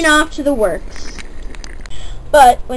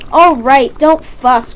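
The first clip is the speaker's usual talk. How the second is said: plain reading voice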